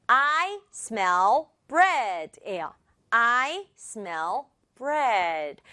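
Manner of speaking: slow, evenly spaced careful reading; word by word. Shown as a demonstration. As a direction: read each word separately and distinctly. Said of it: "또박또박 선생님이 읽어봤는데".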